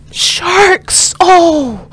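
high, gasped exclamation; thrilled, high-pitched outburst; real excited gasp. Real, not acted. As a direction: gasp and call it out in thrilled shock. Startled delight at sharks on screen.

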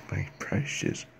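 quick, hushed creature whisper; creature impression. Second take of creature line.

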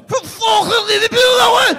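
high, loud hammed-up Scouse shout; exaggerated Scouse. Audience member revealing he's from Liverpool, hammed-up Scouse shout. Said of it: "He didn't have a Scouse accent".

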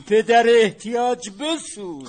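a desperate creditor wails about his need; desperate loud plea. Acted. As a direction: drag out the lament loudly.

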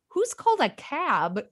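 slow, drawn-out scoffing question; mocking an out-of-touch author, slow scoff; mock incredulous. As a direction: scoff at the outdated detail. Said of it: "And I'm like".

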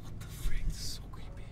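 whispered, creeped out; scared whisper. Real, not acted. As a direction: breathe it under your breath. Creeped out in car.

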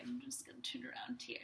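soft unvoiced aside; whispered aside. Real, not acted. Teacher whispering while turning around. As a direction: whisper it to yourself.

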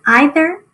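"either" as EYE-ther; "either" with a long I first; long-I pronunciation. Shown as a demonstration. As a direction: open "either" with an eye sound. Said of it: "two ways people, um, pronounce that word".

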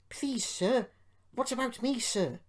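high, anxious little plea; small pleading voice, worried. A narrator's reading. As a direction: ask timidly, pitched right up. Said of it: "asked Peter Sam anxiously".

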